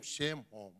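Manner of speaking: ordinary delivery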